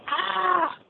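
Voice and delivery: high yelp of dismay; mock horrified yelp. Trucker hears the crowd cheer, mock horrified yelp.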